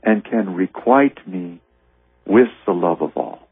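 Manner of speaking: lifted, unhurried reading of a holy text; slow prayerful reading over music; slow devotional reading. Acted. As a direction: draw out each phrase like a prayer. Reading Jesus' words slowly.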